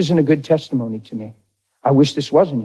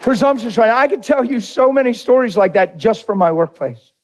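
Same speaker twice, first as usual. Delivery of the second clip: excited; 9 semitones higher than usual. Real, not acted. Still excited after the story.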